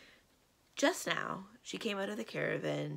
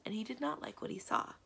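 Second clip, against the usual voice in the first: ordinary delivery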